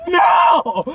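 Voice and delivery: loud, high, drawn-out protest; hammed-up cry of dismay; horrified mock cry. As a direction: wail it in exaggerated disbelief. Horrified reaction, retold.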